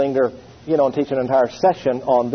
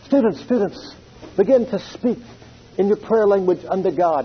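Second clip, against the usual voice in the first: lightly voiced old preacher; a hint of a revival preacher calling his students; imitating the preacher; 3 semitones higher than usual. Preacher voices Oral Roberts.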